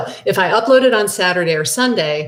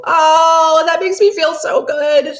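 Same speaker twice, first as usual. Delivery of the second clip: high, loud, touched exclamation; delighted; 9 semitones higher, 5 dB louder, slower than usual. Real, not acted. Viewer compares finding her to a lottery win, she gushes loudly. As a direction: gush with real joy at the compliment. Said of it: "I feel like I just got a winning lottery ticket".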